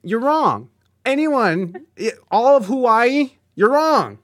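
mock indignant verdict on Hawaii; mock outrage. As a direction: playfully scold the pineapple lovers. Mock outrage at pineapple pizza fans.

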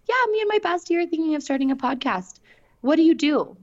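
high-pitched imitation of keen newcomers; lightly mocking high voice; eager inquirer. Voices eager people asking for advice.

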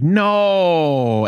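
high, long drawn-out no; retold protest. Husband correcting his wife's mishearing, drawn-out protest. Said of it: "And I was like".

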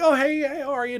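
high, sing-song pleasantries; mock greeting. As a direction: brightly mimic his own greeting. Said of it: "and I'm like".

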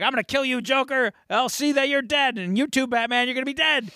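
high-pitched, hammed-up raving; mock furious threat. Retold candidate threatening his enemies, mock furious raving.

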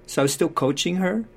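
usual speaking voice

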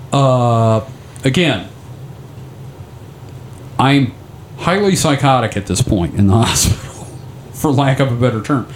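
ordinary talk, nothing marked

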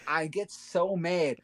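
ordinary talk

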